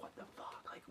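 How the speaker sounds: hushed, breathless whisper of panic; scared whisper. Retold or imitated. Scared kid whispering to her sister about the stranger, hushed. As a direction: whisper it so the man at the door can't hear. Kids spotting creepy man at door.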